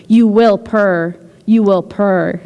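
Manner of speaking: slow repeated chant of "You will purr." with "you" leaned on; hypnotic murmur. Acted. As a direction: keep repeating it, lulling and slow. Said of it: "in a hypnotic murmur".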